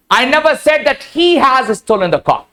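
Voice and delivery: "he" stretched and emphasised mid-sentence; single out "he" as the wrong person; stress on HE. Shown as a demonstration. Teacher showing students who is meant, stress on "he". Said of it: "इसके बारे में तो मैंने नहीं कहा".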